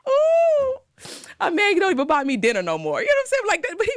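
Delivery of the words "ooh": very high, loud crying ooh; high swooning wail. Real, not acted. Swooning reaction.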